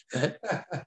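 soft chuckle at his own story; short, quiet real laugh; amused laugh. Real, not acted.

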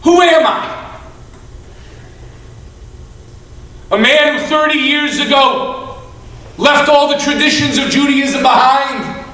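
loud, high impassioned questioning; anguished outcry. Acted. Sobbing man's acted outcry about himself.

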